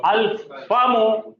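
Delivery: अल फामू slowly, ल kept clear; slow Arabic reading. Shown as a demonstration. Teacher to class, slowly reading the moon-letter word. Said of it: "बढ़िए".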